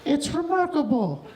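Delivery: slow, dry grandma verdict; a grandma pronouncing judgement, slowed down. Retold or imitated. Grandma judging the ugly baby, slow and dry. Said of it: "my grandma's like".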